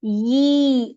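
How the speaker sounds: "یی" drawn out as a long clear ee vowel; "یی" demonstrated as a held, clear ee; long ee sound. Shown as a demonstration. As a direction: stretch "یی" for the students to hear. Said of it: "یا کی آواز کو اچھی طریقے سے نکالیں".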